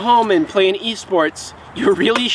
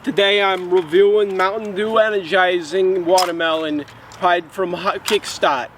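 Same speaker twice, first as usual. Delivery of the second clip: a light put-on Australian accent, hammed-up; goofy mild Australian accent for a drink review; mock Australian accent. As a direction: play a jokey Aussie reviewer with a half-hearted Australian accent. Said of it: "my Mad Lib Australian accent".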